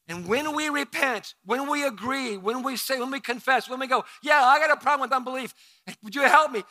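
loud, high, building preacher's cry; preaching at full force. Acted. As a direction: preach it out, rising to a roar.

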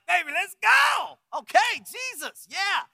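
shouted high, slow and jubilant; excited shout. Retold or imitated. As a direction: yell it out like you're on a spiritual high. Retelling the spiritual high.

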